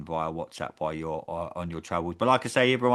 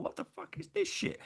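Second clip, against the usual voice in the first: squeaky high mock disgust, quieter; mock outraged viewer; 9 dB quieter than usual. Fan mocking Superman in a squeaky high outraged voice. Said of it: "A la Neil and you".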